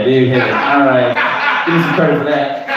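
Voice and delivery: ordinary delivery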